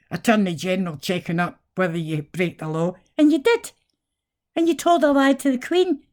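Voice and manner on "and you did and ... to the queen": high indignant accusation; rising outrage at the government; outraged, higher. Real, not acted. Accusing politicians.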